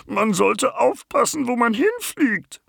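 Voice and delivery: very high, slow creature voice; small creature grumbling. Acted. The bumblebee scolds after the crash. (sehr hohe, langsame Tierstimme, leicht brummig)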